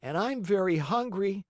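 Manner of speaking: put-on high child voice; high, plaintive marionette; hungry puppet boy. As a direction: chime in as a high-pitched little boy. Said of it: "said Pinocchio".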